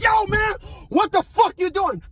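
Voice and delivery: high, shouted panic at the driver; alarmed shout. Retold or imitated. Passenger yelling at his friend hitting gears, alarmed shout. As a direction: yell it in mock alarm from the passenger seat. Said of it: "I'm in the passenger seat like".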